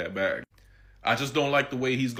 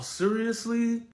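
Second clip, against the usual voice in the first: incredulous; 13 semitones higher, much slower than usual. Real, not acted. Incredulous at [name]'s request.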